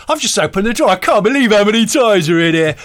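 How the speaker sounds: mock excitable amazement; amazed presenter impression. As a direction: marvel loudly like an over-keen presenter. Mimicking loud amazed factory presenter.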